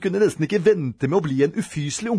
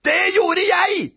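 high, loud, drawn-out insistence; angry shriek; 5 dB louder, slower than usual. A narrator's reading. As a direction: shriek it back at your brother. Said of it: "skrek Robin".

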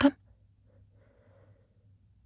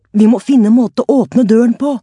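loud, higher, muffled behind an arm; coughing through arm; 7 semitones higher, 7 dB louder than usual. A narrator's reading. Luan urging the others in smoke, muffled coughing shout. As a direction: shout through the sleeve over the mouth. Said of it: "hostet Luan med armen foran munnen".

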